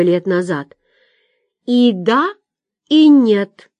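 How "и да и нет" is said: ordinary delivery, nothing marked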